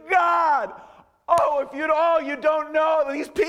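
loud, high, wailing plea; mock desperate prayer. Preacher showing how Elijah didn't pray, desperate wail. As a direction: cry out in hammed-up anguish. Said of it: "Did he start saying".

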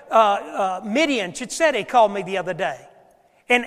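normal talking voice

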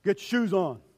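loud, firm order; a grandfather telling the boys firmly; stern command. Retold or imitated. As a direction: bark it at the kids. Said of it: "Notice how the voice goes down".

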